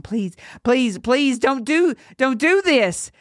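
high, desperate begging; choked pleading. Acted. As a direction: choke out a frightened plea. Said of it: "he choked out".

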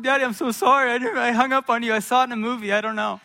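put-on high, loud, pleading kid's apology; mock crying child. Crying boy confessing to his dad on the phone, mock tearful. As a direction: blurt it out like a tearful little boy, hammed-up. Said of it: "I start crying".

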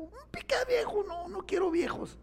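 high, squeaky imitation of the wife; wife refusing an old pickup, in a high mocking voice. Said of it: "y mi señora me dijo".

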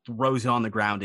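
ordinary delivery

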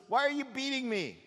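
a wounded animal asking why; hurt donkey protests; plaintive talking donkey. Acted. Talking donkey asks prophet.

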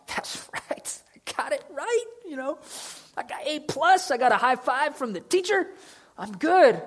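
high, pleased with himself, with a laugh; a high, giggly mock brag; smug Peter. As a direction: crow like the teacher's pet.

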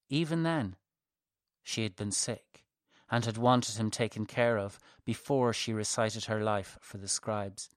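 plain reading voice

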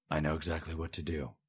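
soft, plain and steady; said simply. A narrator's reading. Servant calmly reassuring his empress, softly. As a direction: state it simply and quietly. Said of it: "he said simply".

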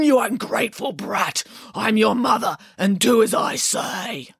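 rough, scolding wolf. A narrator's reading. Wolf scolding piglets while posing as mother, rough voice. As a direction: scold roughly, dragging out the last words. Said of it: "Your voice is too rough".

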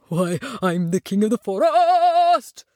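slow boast swelling into a loud roar; puffed-up lion. A narrator's reading. Newly decorated lion proclaims himself king, puffed-up. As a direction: boast big, belting out the last word. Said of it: "with his chest puffed up high".

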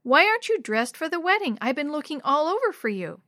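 flustered grown-up reproach; exasperated scolding. Acted. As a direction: scold a child who's late for a wedding. Adult scolds Maria for being late.

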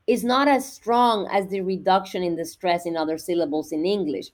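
ordinary delivery

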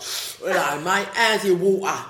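loud South East London patter, thickly put on; exaggerated South London greeting; broad South East London accent. Shown as a demonstration. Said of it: "I'd be talking all like that".

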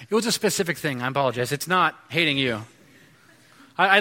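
ordinary talk, nothing marked

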